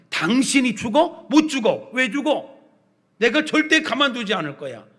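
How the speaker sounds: loud, high, punchy challenges; scolding protest. Acted. Peter grabbing Jesus by the clothes to stop him, scolding loudly. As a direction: throw each question out as an angry refusal. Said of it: "예수님을 막 꾸짖듯이".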